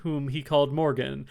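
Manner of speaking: usual speaking voice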